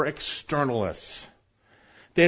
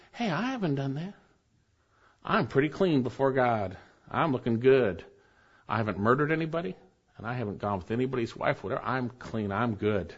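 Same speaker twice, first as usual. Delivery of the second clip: softer, low, offhand self-justifying voice; mock self-assurance; 6 semitones lower, 8 dB quieter than usual. Preacher voices listeners excusing themselves before God, quietly self-assured.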